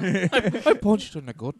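mock Scottish accent. Co-host teasing host's failed accent, mock Scottish. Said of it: "a fucking Scottish accent".

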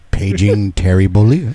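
paging announcer. Pretend. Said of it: "We have a paging system".

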